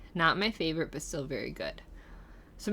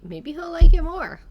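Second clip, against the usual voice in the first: excited lift; 9 semitones higher, 12 dB louder than usual. Real, not acted. Hopeful guess about husband's taste.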